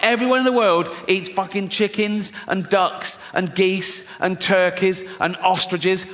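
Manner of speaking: ordinary talk, nothing marked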